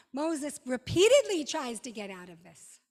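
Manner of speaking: ordinary talk, nothing marked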